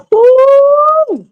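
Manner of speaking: loud, high relieved whoop. Real, not acted. Woman recalling gas pain whoops out loud, high and relieved.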